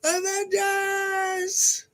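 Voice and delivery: very high, drawn-out battle call; hammed-up hero rally cry; Avengers rallying yell. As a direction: call the team to arms, stretching it out. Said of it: "when you yell".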